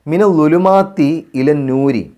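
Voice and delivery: slow, drawn-out chanted recitation; measured Quranic chant. Shown as a demonstration. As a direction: recite it slowly with tajweed.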